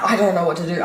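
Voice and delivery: ordinary talk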